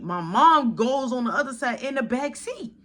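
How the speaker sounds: ordinary delivery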